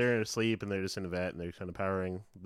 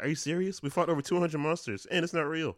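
higher, louder mock indignation; mock indignant Power Ranger; indignant actor; 7 semitones higher, 5 dB louder than usual. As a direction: play the offended Ranger. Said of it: "he's like".

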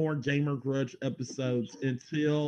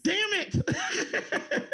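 high-pitched mock-angry yelp; exasperated high yell; frustrated outburst. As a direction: cry it out in comic exasperation. Host put off by guests.

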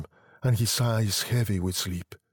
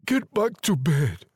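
slow, raised-pitch, shaken order; voice shaking; 8 semitones higher, 3 dB louder than usual. A narrator's reading. Husband sending his wife to bed, shaken. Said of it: "his voice shaking".

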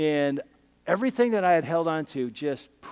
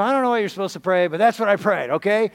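defensive retort; 4 semitones higher, 7 dB louder than usual. Retold or imitated. Defensive reply to his friend.

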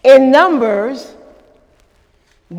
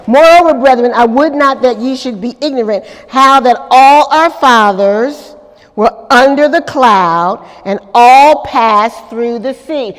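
loud, emphatic Bible reading; scripture reading; 5 dB louder than usual. Acted. Preacher reads the verse to the congregation, loud and proclaiming. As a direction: proclaim the verse, leaning on key words.

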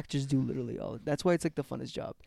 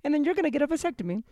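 nagging wife; 12 semitones higher than usual. Acted. Plays nagging wife in improv.

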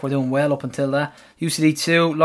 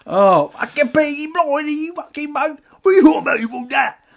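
put-on London accent; 10 semitones higher than usual. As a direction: go full London geezer, shouting. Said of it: "say it in a London accent".

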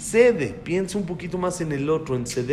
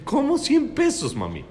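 higher, slower, laughing disbelief; amazed question; 8 semitones higher than usual. Retold or imitated. Father asking his daughter the price, laughing and amazed.

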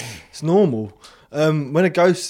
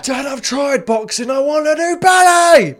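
put-on high, loud, slow pleading boy; mock Billy Elliot boy; 11 semitones higher, 7 dB louder, slower than usual. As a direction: beg dad loudly to do ballet. Imitating the ghost boy, Billy Elliot.

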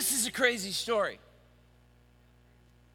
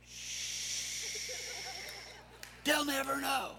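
hushing fish; 5 semitones higher, 10 dB quieter, much slower than usual. Acted. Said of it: "there are fish under there going".

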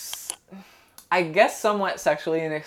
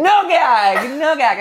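loud, high and gleeful; excited shout; 11 semitones higher, 8 dB louder, slower than usual. Real, not acted. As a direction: crow it out with glee. Echoing friend's joke, loud.

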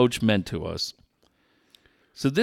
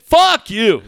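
loud curse; 8 semitones higher, 7 dB louder than usual. Retold or imitated. Repeating the yell.

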